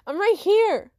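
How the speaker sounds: high, loud roll-call answer; over-eager shout. Acted. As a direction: holler it high so nobody misses you. Pupil answers, told to calm down.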